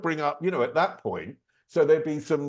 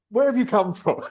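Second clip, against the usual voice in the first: higher-pitched mock Geordie question; Newcastle accent; 7 semitones higher, 4 dB louder than usual. Visitor retells the doorwoman quizzing him, higher light Newcastle accent. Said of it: "In a Newcastle accent".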